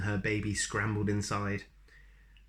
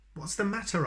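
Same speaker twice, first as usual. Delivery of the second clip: concerned question; 10 semitones higher, faster than usual. Acted. George asks the mouse.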